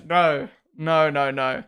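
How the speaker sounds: normal talking voice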